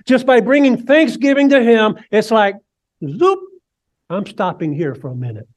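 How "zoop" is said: zoop sound. Acted. Sound of God stopping.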